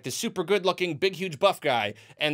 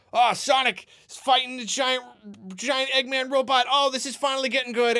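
brighter and higher, quickening at the end; mock excitement; 6 semitones higher than usual. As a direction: play the fan getting hyped at last. Mocking excited movie fan.

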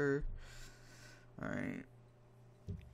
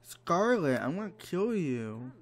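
frustrated yell; 8 semitones higher, 3 dB louder than usual. Real, not acted. Angry at Sim Scarlet.

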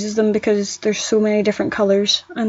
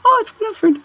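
high-pitched, delighted little exclamation; mock recognition; 13 semitones higher than usual. Recalling the farmers near us, high excited mock recognition. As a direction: squeal it lightly as if spotting someone familiar. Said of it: "I was always like".

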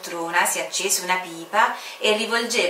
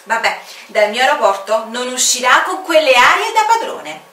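louder, irritated muttering resolve; annoyed official vowing to hold firm; a touch of indignation; grumbling commander; 5 semitones higher, 8 dB louder than usual. Acted.

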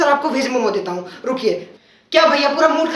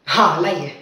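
usual speaking voice

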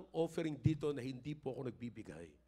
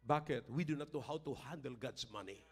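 normal talking voice

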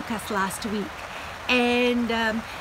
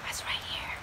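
unvoiced secret whisper; 10 dB quieter, faster than usual. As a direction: whisper so the kids won't hear. Secret aside while hiding bugs.

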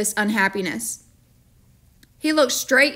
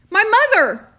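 much higher, louder cry; frantic boy; 11 semitones higher, 6 dB louder than usual. A narrator's reading. Boy frantically reminding the storekeeper, much higher and louder. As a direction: cry it out in panic. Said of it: "reminded the storekeeper frantically".

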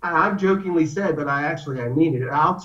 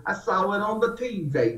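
put-on Southern accent; 3 semitones higher, 6 dB quieter than usual. Quoting his Southern friend, in a light Southern accent. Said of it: "With the Southern accent".

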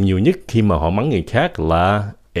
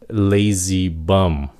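ordinary talk, nothing marked

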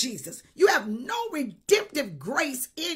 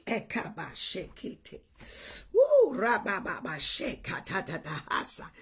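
lower, rapid ecstatic tongues; speaking in tongues; 7 semitones lower, 3 dB quieter than usual. Real, not acted. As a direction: let the tongues pour out. Preacher breaks into tongues.

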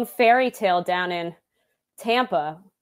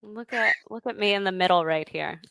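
ordinary talk, nothing marked

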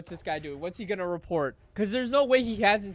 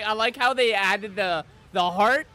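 ordinary talk

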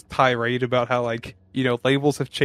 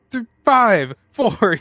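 panicky count; 7 semitones higher, 6 dB louder, much slower than usual. Retold or imitated. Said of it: "He's like".

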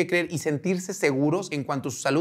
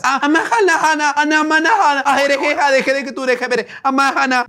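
much higher, sing-song fake spiritual gibberish; mock mystic babble; 9 semitones higher, 4 dB louder than usual. Vlogger mocking a commenter's spiritual nonsense with chanting. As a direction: parody a new-age chant. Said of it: "O sea, sus palabras".

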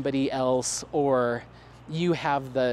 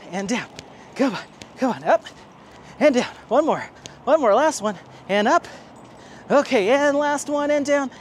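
eager coaching; 8 semitones higher, 4 dB louder than usual. Real, not acted. Coaching dog through reps.

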